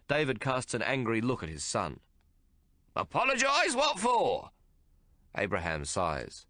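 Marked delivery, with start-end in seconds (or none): 3.0-4.5 put-on high incredulous old man; cantankerous father scoffing; indignant old man. A narrator's reading. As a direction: scoff at the very idea. Angry old man at son.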